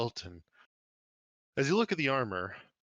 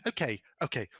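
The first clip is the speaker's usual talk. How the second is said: higher gnome woman voice; a gnome woman giving in to a higher offer; 4 semitones higher than usual. Acted. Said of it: "the gnome woman goes".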